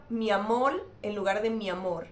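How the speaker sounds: ordinary talk, nothing marked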